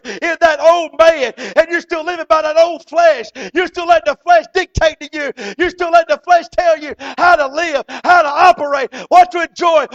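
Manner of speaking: shouted, high-pitched sermon climax; preaching at full force. Acted. Preacher driving the message home, shouting.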